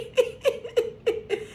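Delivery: short airy laugh, no voice; a quick breathless giggle; high breathy laugh. Real, not acted. Laughs at own joke.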